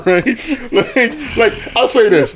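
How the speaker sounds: high squealing laughter through the words; can barely get words out for laughing; laughing hard. Real, not acted. Friend cracking up while arguing about sex.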